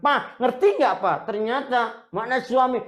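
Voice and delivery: wife aggressively lecturing her husband; pushy shouting wife. Acted. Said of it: "Jangan, / ngegas-ngegas".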